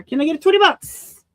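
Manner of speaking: high, loud auctioneer call. Pretend. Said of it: "Feel like an auctioneer".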